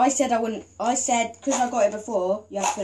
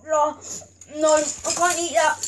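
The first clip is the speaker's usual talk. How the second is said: panicked protest through a mouthful; eating gross jelly beans; 4 semitones higher than usual. Real, not acted. Gagging on bean.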